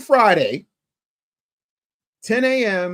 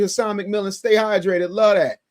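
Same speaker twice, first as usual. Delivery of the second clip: normal talking voice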